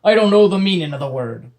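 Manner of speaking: loud pirate bark; barked pirate line. Acted. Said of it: "Bloody John barked".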